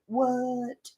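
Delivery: high, soft, drawn-out disbelief; imitating a stunned audience; mock amazement. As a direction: stretch it softly upward.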